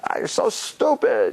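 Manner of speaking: put-on a teen teasing a friend, high and slow; mock teen taunt. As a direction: drag it out like a teen teasing a pal. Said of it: "Say whatever you want".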